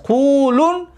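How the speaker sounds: قولون as qulun, short second u, rising; dropped waw. Shown as a demonstration. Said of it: "پس در اینجا واو حذف شدگی است".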